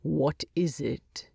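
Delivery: cold, slow dwarf grumble; icy disdain. A narrator's reading. Thorin to Bilbo, slow icy disdain. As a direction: look down on him and ask coldly. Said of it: "with icy disdain".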